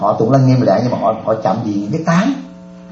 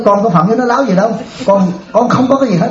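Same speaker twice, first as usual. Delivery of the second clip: indignant protest; 7 semitones higher than usual. Retold or imitated. Monk protesting to the abbot.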